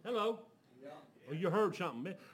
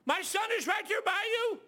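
high, loud excited yell; father yelling into the phone, loud and high; 7 dB louder than usual. Retold or imitated. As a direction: shout it out in disbelief. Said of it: "he started yelling".

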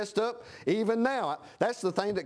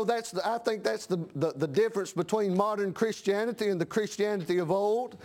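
usual speaking voice

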